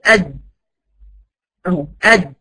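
أد said with the jaw shut tight, no release; أد clipped shut, the jeem held closed; closed jeem stop. Shown as a demonstration. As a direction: cut أد off short with a closed mouth. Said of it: "قفلت خالص".

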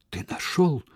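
a high, gasping question; breathless girl asks Decimus. Acted. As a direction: ask it out of breath. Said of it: "задыхаясь".

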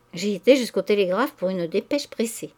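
ordinary delivery

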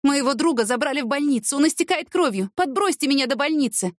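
high-pitched, urgent appeal; pleading. A narrator's reading. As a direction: beg a stranger for a lift. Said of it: "попросила Цветкова". (взволнованная просьба, высоким голосом)

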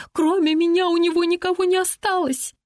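very high, plaintive excuse; self-justifying. A narrator's reading. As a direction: plead your case in a high-pitched voice. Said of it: "оправдывается Сисси". (оправдываясь, очень высоким голосом)

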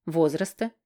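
ordinary delivery, nothing marked

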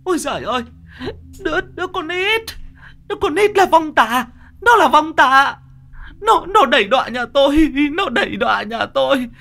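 very high-pitched, frantic wail; loud hysterical shrieking, crying to heaven; horrified wailing scream. A narrator's reading. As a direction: scream it out like a terrified, grieving widow. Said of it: "bà gào lên".

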